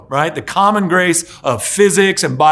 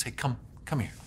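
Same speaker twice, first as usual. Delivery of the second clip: much softer, gentle call; Jesus quietly calling the weary to him; tender invitation; 4 semitones lower, 12 dB quieter than usual. Acted. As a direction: draw them in close and soft. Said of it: "where he says".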